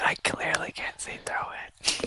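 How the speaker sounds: whispered retort to the fog; whispering back. Acted. Player answers the whispers in whisper.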